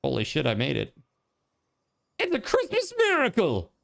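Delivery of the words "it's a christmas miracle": high squealing celebration; excited. Real, not acted. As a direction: shriek it in triumph. Made the jump, celebrating.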